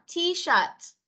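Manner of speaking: "T-shirt" high, last T aspirated; high corrective model "T-shirt"; final T released. Shown as a demonstration. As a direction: sound the ending T of "T-shirt". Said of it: "Âm thờ đâu?".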